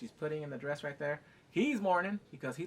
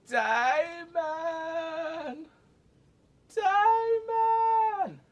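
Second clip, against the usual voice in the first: long, high wail of a name; screamed call; 13 semitones higher, 8 dB louder, much slower than usual. Retold or imitated. Laboring wife calling her husband, drawn-out mock scream. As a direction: holler it through the walls, stretched out. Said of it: "you hear her in the background".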